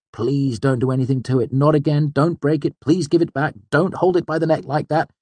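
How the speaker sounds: high, urgent desperate pleading; panicked, high-pitched pleas building in urgency; pleading cry. A narrator's reading. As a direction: beg frantically for it back. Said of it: "The first part cried".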